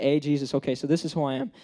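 low, soft, self-satisfied pitch to Jesus; mock resume recital, low and quiet; smooth talker. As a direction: casually sell yourself, voice dropped and laid-back. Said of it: "I'm a smooth talker".

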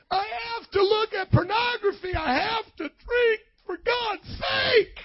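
very high, drawn-out fake wail; hammed-up whine of protest; mock whining complainer. Mimics complaint about God's commands.

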